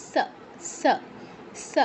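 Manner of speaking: "স" as a sharp dental sa; isolated sa syllables for "স", no sh; dental sibilant. Shown as a demonstration. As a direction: say "স" as sa, tongue at the upper teeth. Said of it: "উপরের পাটির দাঁতে স্পর্শ করবে জিহ্বা".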